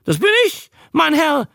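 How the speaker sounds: very high, loud proud cry; a shrill-pitched fairground barker claiming the captain's name; proud little showman. Acted. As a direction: thump your chest and announce yourself at a fairground. Showman proudly beating his chest.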